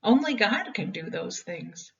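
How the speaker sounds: ordinary delivery, nothing marked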